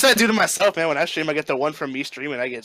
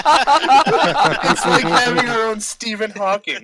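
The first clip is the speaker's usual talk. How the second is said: speaking through loud laughter; laughing; 10 semitones higher, 6 dB louder, much slower than usual. Real, not acted. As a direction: laugh while saying it.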